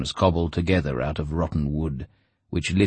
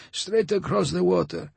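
higher, with a laugh breaking in; chuckling; 13 semitones higher than usual. A narrator's reading. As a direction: chuckle through the words. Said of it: "Homer chuckled".

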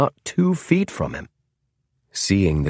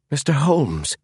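higher-pitched, surprised recognition; almost involuntary; 7 semitones higher than usual. A narrator's reading. James recognizing the tall man's face, almost involuntary. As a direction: let the name slip out in surprise. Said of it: "he said almost involuntarily".

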